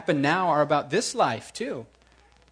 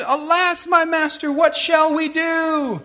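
high, slow, frightened cry of the servant; panicked servant; 10 semitones higher, 3 dB louder, slower than usual. Acted. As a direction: wail it out like a terrified servant. Servant panics before the army.

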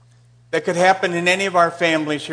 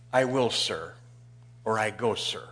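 soft, lower respectful reply; 7 semitones lower, 6 dB quieter than usual. Acted. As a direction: answer politely like an obedient son. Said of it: "Very respectful".

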